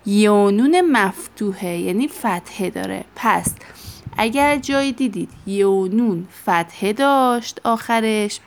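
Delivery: ordinary talk, nothing marked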